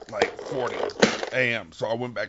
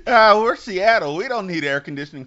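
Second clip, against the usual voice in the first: mocking voice; 10 semitones higher, 7 dB louder than usual. Mocking the Seattle local.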